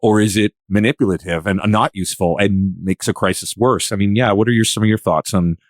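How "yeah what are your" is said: normal talking voice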